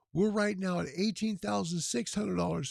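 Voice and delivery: ordinary talk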